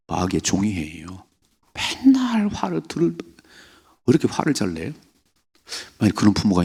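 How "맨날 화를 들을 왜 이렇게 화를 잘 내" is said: mock nagging. Mocking an angry person.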